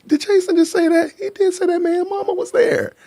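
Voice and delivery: very high-pitched, incredulous squeal; amused disbelief. Real, not acted. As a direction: squeak it out like you can't believe they said it. Reacting amused to a chat remark.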